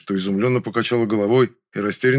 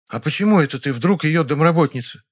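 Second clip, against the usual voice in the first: higher-pitched, probing woman; suspicious; 6 semitones higher, 3 dB louder than usual. A narrator's reading. Dusya challenges Nyura about being housekeeper, suspicious. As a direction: question her claim with suspicion. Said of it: "с подозрением посмотрела на неё Дуся".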